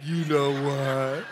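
low, slow with a laugh in it; parrot's knowing punchline, low and slow with a laugh; sly parrot. Retold or imitated. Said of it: "the bird goes".

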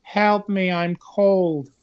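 flat, half-hearted calls for help; weak trapped cry for help, no effort; unenthusiastic cry for help. Retold or imitated. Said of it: "in the most unenthusiastic voice".

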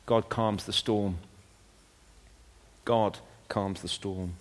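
very soft, low and unhurried; hushed reassurance. Real, not acted. Preacher assuring church that God calms the storm, hushed and low. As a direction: let the voice drop right down into stillness. Calm after the acted storm.

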